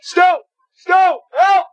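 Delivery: high, loud, frantic yell; panicked cry for help. Acted. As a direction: scream for rescue. Trapped, calling for help.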